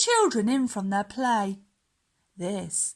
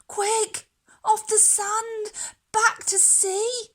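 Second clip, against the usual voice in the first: much higher and louder, a frantic cry; tiny snail crying out urgently to the stranded whale; 12 semitones higher, 5 dB louder than usual. Acted. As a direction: shout in a panic to save the whale. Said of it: "cried the snail".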